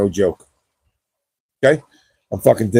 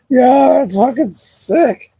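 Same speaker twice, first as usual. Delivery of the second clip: excited; 12 semitones higher, much slower than usual. Real, not acted. Reacting to chat news.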